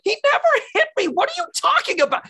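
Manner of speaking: very high, fast squeaky protest; mock denial. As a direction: squeal it out in a rush. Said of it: "And it's like".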